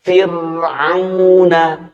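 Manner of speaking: "fir'auna" drawn out slowly, thin ro; slow tajwid recitation; Quranic recitation. Shown as a demonstration. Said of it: "Asli itu contohnya ada pada kata".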